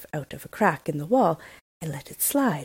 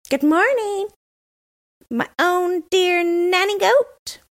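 high, loud, slow mock-sweet greeting; teasing robber girl; 14 semitones higher, 6 dB louder, slower than usual. A narrator's reading. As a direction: tease mother with a sugary hello. Robber girl teasing her mother.

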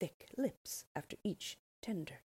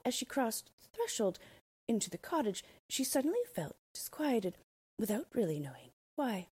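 ordinary delivery, nothing marked

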